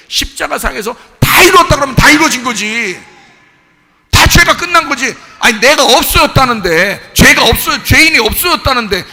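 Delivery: loud, high, protesting sermon; impassioned preaching. Real, not acted. Preacher protesting that all is finished, impassioned. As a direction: hurl the challenge at full force.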